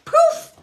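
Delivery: high, loud "poof"; sudden comic burst of sound; imitated kick noise. Medium acts out a spirit kicking butt, comic high "poof".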